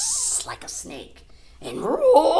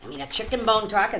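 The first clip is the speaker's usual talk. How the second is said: usual speaking voice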